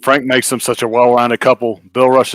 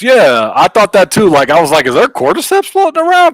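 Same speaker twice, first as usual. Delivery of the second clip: high-pitched, excited outburst; excited agreement; 7 semitones higher, 3 dB louder than usual. Real, not acted. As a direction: jump in high and eager, pitch soaring on the question. Excitedly agrees with viewer's cordyceps comment.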